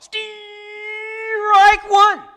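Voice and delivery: high, loud umpire bellow; umpire's call. Acted. Umpire calling the strike to the whole stadium, loud and high. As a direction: belt out the call like an umpire behind the plate.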